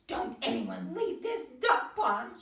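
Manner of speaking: frantic mother duck yelling; shouting. A narrator's reading. Missus Duck searching for Harold, frantic shout. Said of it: "she shouted".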